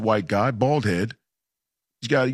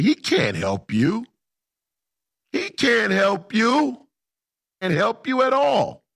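high, drawn-out retort climbing higher; emphatic retold comeback; 11 semitones higher, slower than usual. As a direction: stretch each word out in a raised pitch. Retold sharp comeback to a questioner.

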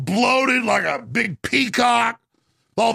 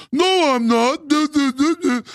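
high dopey mimicry; jeering imitation of an idiot; mock idiot; 6 semitones higher than usual. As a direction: play the fool, pitched up, babbling.

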